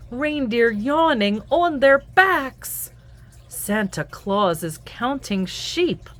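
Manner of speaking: lively sing-song picture-book read-aloud; reading to children. A narrator's reading. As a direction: read it to little ones at bedtime.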